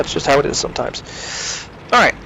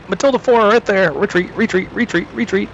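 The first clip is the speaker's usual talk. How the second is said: high, urgent alarmed calls; frantic retreat order; 6 semitones higher than usual. Real, not acted. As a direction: yell to back off fast, panicked. Enemy tank suddenly appears, retreating.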